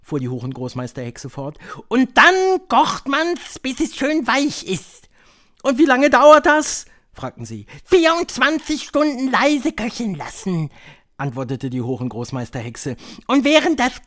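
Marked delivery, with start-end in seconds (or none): 1.9-5.1 high, slow, drawn-out witch voice; high-pitched, deliberate witch lecturer; Grand High Witch. A narrator's reading. 5.6-6.9 high, loud questioning voice; high-pitched, loud crowd question; eager witch audience. A narrator's reading. 7.8-10.7 high, loud witch voice; high-pitched, theatrical witch leader; Grand High Witch. A narrator's reading.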